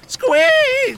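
very high, loud squeal; piercing frightened squeak; alarmed mouse squeak. Acted.

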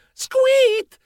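squittio acutissimo; squittio da topo. Acted. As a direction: squittisci come un topolino dei cartoni. Verso da topo del narratore. (squittio acuto e improvviso)

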